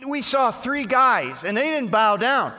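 hammed-up advisors reporting to the king; tattling advisors. Advisors loudly telling the king on the three friends.

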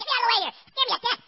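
high, loud retold panic; scared shouting. Frightened husband escaping the storm on deck, retold panicked shout. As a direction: yell to be let out, reliving the scare. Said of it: "man, I was scared".

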